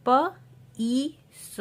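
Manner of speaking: प, ई, स high and apart; sounding out. Shown as a demonstration. Teacher showing IE words, sounding out प, ई, स. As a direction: pause between प, long ई and स. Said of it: "हम ई sound use".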